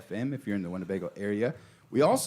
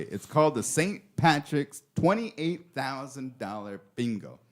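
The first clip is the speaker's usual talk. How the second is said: ordinary delivery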